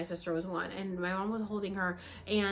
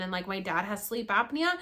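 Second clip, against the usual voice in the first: ordinary delivery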